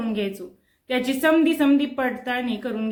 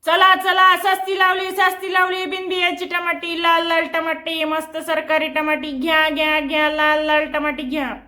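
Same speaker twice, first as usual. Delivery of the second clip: vendor hawking shout; 4 semitones higher than usual. Acted. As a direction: call out to the whole bazaar like a street vendor. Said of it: "गळ्याची दोरी ताणू ताणू ओरडायचा". (बाजारात टमाटी विकणाऱ्या बापाची मोठ्याने आरोळी)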